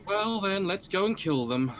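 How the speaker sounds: eager battle cry. Acted. Gleeful battle call.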